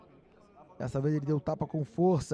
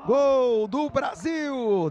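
drawn-out, higher goal cry; goal call; 6 semitones higher, much slower than usual. Real, not acted. Tenth goal called.

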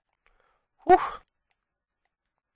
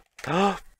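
sharp, high gasp; shocked gasp; 6 semitones higher, 5 dB louder than usual. Real, not acted. Gamer nearly loses the battle, shocked gasp.

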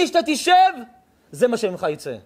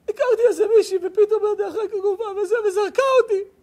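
put-on high whiny teenage boy's voice, much higher; crying teen; 6 semitones higher, faster than usual. A rabbi mimicking the sobbing boy who was dumped, high and whiny.

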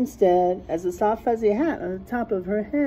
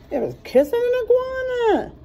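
playful high voice; 14 semitones higher than usual. Acted. Asking the listening child, in a high teasing squeak. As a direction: tease the child with a squeaky question.

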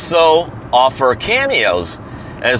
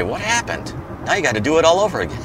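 teasing; 5 semitones higher, 4 dB quieter, faster than usual. Pretend. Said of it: "make fun of them and say".

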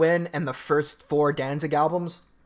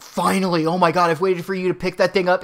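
imitating an overjoyed father, louder; excited dad; 4 semitones higher, 7 dB louder than usual. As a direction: gush like a dad who finally got his wish. Dad thrilled son picks guitar.

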